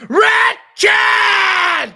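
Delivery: full-force high-pitched shout; demonstrating yelling. Shown as a demonstration. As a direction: bellow the name to show what yelling is. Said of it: "Yelling is".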